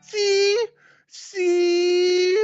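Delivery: high, squeaky mock crowing; mocking fans. Smug fans gloating at Gable, high and squeaky. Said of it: "drones are gonna come back to him and be like".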